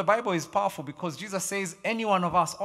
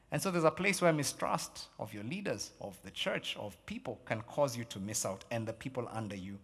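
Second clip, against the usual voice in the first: ordinary talk, nothing marked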